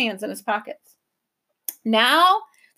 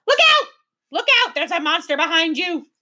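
very high, loud scream of warning; 6 semitones higher, 4 dB louder than usual. A narrator's reading. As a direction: shriek it in false alarm. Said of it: "screamed the gaboons".

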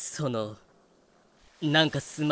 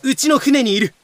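excited blurt; 4 semitones higher, 6 dB louder than usual. Acted. Excited confession, he's on my ship.

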